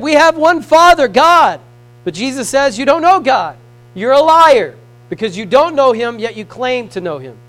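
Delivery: preached rebuke. Acted. Preacher voicing Jesus rebuking Pharisees, loud accusation. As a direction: call them liars to their face.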